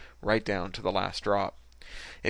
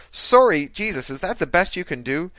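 high, loud start, incredulous; scornful outrage; 8 semitones higher, 7 dB louder than usual. A narrator's reading. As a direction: pour scorn on his apology. She scorns his apology.

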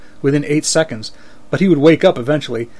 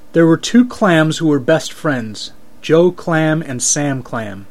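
slow joke telling; slower than usual. Acted. Telling his mom's joke carefully.

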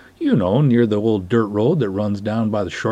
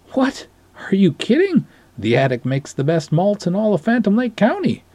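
Sven's voice; 10 semitones higher than usual. Acted. Sven protests enthusiastically, different character.

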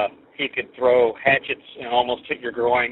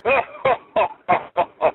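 big booming sidekick guffaw; hearty talk-show sidekick laugh; Ed McMahon laugh; 10 semitones higher than usual. Retold or imitated. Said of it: "So here's the Ed McMahon laugh".

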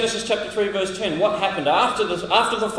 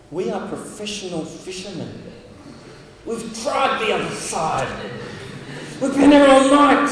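exasperated fishermen; 4 semitones higher, 4 dB louder than usual. Pretend. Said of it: "imagine the disciples thinking".

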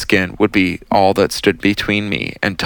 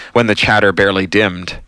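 ordinary delivery, nothing marked

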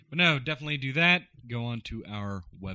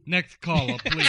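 Ladies' Man impression; 7 semitones higher, 7 dB louder than usual. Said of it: "I'm the ladies' man signing out".

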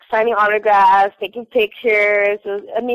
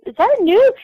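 much higher eager repeat of the question; 10 semitones higher, 3 dB louder, faster than usual. Retold or imitated. Asking Alice again in excited disbelief. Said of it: "I was like".

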